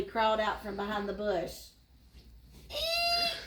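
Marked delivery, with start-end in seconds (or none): 2.7-3.4 sudden high shriek of fright; frightened shriek. A narrator's reading. Sammy spots a leopard crawling from a bush, shrieks high in fear. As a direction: squeal high as a leopard appears. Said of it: "Sammy shrieked in fear".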